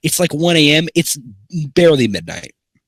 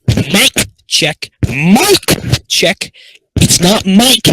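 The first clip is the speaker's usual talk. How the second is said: loud, high shouting; 8 semitones higher, 8 dB louder, much slower than usual. Real, not acted. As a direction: yell it into the mic. Shouting into mic.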